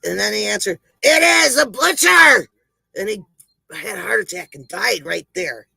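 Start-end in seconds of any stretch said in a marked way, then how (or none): 1.0-2.5 high, loud, fed-up shout; exasperated shout. Acted. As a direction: yell it through the door at last. Butcher loses patience, shouts.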